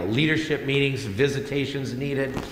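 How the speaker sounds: usual speaking voice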